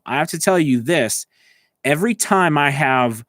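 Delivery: normal talking voice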